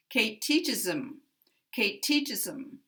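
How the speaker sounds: teaches stressed, him weakened to im; reduced him. Shown as a demonstration. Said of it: "we reduce it".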